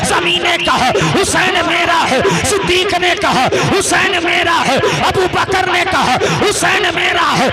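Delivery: loud, high fiery sermon cry; preaching at full force. Acted. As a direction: call it out to the crowd in rising fervour.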